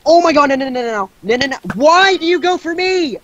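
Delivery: loud panicked no no no; yelling in real panic; frantic yell. Real, not acted. Attacked in game.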